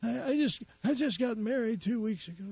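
put-on high, teary old man voice. Crying old man tells the policeman his news, high and weepy. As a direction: play a weepy old timer. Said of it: "And the old man says".

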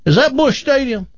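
high, scoffing boastful Texan; bragging Texan. Acted. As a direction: scoff and brag like a big-talking Texan. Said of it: "And he goes".

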